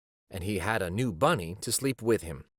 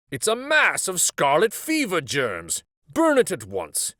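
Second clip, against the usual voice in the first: stern doctor; 7 semitones higher, 6 dB louder than usual. A narrator's reading. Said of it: "said the doctor".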